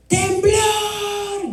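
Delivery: high panicked shout. Retold or imitated. Girl alerting her sleeping mother to a quake, panicked shout. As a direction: yell the alarm as the walls shake. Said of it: "grité".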